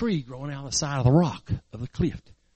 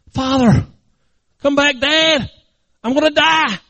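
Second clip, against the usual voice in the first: raised, high plea; panicked hollering; 10 semitones higher, 6 dB louder, slower than usual. Acted. Boy calling back his departing father, panicked hollering. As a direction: call after someone running away. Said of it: "he starts hollering".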